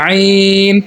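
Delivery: "আইন" from mid-throat with light pressure; squeezed ayn. Shown as a demonstration. Teacher showing pupils ayn, "আইন" lightly squeezed in the throat. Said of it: "হালকা চাপ দিয়া তারপর উচ্চারণ করবো".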